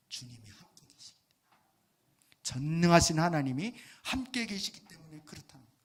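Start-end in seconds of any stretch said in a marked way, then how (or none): 0.1-2.4 whispered answer. Acted. Quiet answer before emphatic repeat. 2.4-5.2 firm, louder declaration stressing 함께; emphatic declaration. Acted. Emphatic repeat after whisper.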